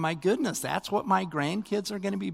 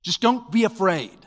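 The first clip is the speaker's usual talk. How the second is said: loud, higher mock scolding; mock stern command; 5 semitones higher, 7 dB louder than usual. Preacher mimics a harsh God, mock stern and loud. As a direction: bark it as the God he is not. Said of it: "God's not just saying".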